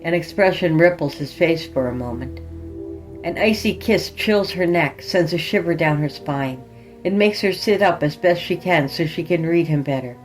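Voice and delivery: ordinary delivery, nothing marked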